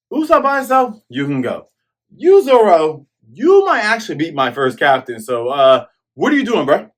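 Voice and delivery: high, loud mock taunt; hammed-up villain jeering; voicing Kuro. Kuro taunts Zoro on the slope, high loud mock voice.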